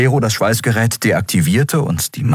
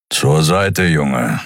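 grumbling Keru; much slower than usual. A narrator's reading. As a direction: grumble the order to the boy. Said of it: "brummte er". (brummend, mürrisch)

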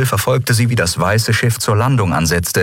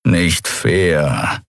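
dejected; 3 semitones lower than usual. Acted. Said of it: "mit hängenden Schultern".

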